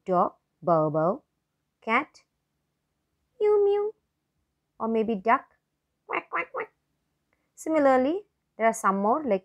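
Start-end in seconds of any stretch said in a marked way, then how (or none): 0.6-1.2 slow imitated bow bow bark; dog barking. Shown as a demonstration. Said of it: "You can also say". 3.4-3.9 high squeaky mew mew; cat meowing. Shown as a demonstration. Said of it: "You can also say". 6.1-6.7 high quack quack quack; duck quacking. Shown as a demonstration. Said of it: "You can also say".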